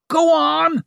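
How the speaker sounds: shrill furious scream. A narrator's reading. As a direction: scream it at their backs. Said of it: "she screamed at their backs".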